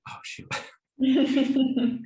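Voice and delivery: embarrassed giggle through the words; laughing. Real, not acted. Forgets a word, laughs at herself.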